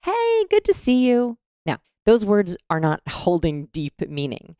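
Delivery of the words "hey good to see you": high, drawn-out sing-song greeting; call greeting. Shown as a demonstration. Woman demonstrating how we open a call, high drawn-out greeting. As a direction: stretch it out, pitched way up. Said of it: "at the very beginning of a call".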